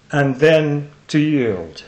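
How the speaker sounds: long pause, then "then" drawn out slowly; changed line revealed. Shown as a demonstration. Speaker revealing the poem's changed last line, slow emphatic recitation. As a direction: land "and then to yield" very slowly, weighting "then". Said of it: "was very significantly changed, and I'll tell you how".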